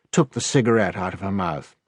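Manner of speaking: plain reading voice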